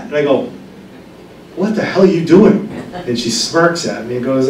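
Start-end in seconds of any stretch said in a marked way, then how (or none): 1.5-2.7 exasperated outcry. Retold or imitated. Scolding the sleeping mother.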